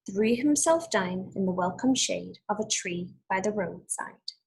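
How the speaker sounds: put-on clear, measured read-aloud for children; storybook reading voice; story narration. A narrator's reading. Teacher reads fable aloud.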